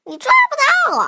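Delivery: shouted taunt. Acted. Child taunts as gingerbread man.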